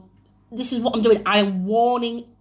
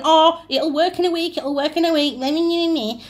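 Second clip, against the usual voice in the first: higher-pitched sing-song mockery; mocking promise; 6 semitones higher than usual. Witch mimicking false promises of a time frame to clients, mocking. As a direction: mimic an empty promise, sliding into meaningless babble.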